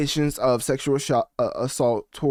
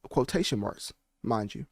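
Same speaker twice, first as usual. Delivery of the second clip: ordinary talk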